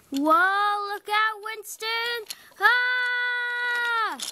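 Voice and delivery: very high, loud cry of alarm; Ah held high and long before the derailment; panicked scream. Acted. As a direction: scream the warning as the crash comes. Crash about to happen.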